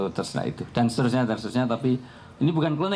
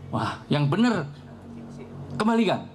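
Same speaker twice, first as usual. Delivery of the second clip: sharp high angry order; a father scolding his son to give it back; 7 semitones higher than usual. Retold or imitated. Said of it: "Marah Umar".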